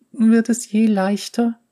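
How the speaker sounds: put-on high girl voice after crying; young girl voice. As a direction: ask with a sniffle. Girl asks tearfully.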